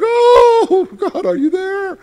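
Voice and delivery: high, loud, pleading cry; desperate cry to God. Retold or imitated. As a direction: cry out to God as if lost in a crisis. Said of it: "And I'm like".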